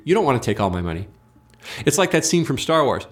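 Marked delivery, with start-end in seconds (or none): 0.0-1.1 quick, low recited denial; compliant denial. Acted. Giving the required lie back to the radical, compliant. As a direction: rattle it off lower, as a required answer. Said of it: "My response is required to be".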